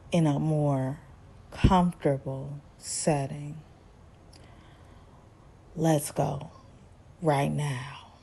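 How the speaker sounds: very soft, low, with lingering pauses; mock creepy seduction, low and hushed. Recapper voices Stan luring Lisa to his place, creepy. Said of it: "this whole moment was creep fest".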